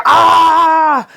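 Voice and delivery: high, loud cry of rage; frustrated yell. Retold or imitated. Trig losing it after the fight, raging yell. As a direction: let out a raw frustrated yell. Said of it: "Trig was losing it".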